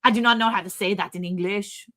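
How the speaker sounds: imitated French-accented English; careful French-accented protest. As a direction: play the French neighbor, stumped by English. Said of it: "Are you gonna do your French accent".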